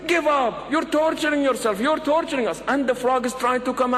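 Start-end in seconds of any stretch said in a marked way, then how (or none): 0.1-2.6 much higher, imploring mock yell; acted cries urging someone to quit. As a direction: shout pleadingly as the onlooking friends. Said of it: "they are yelling".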